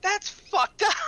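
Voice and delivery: very high, loud squeal; squeaky outrage. Retold or imitated. Insulted traveler squeaks his shock at the insult, very high. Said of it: "I'm like".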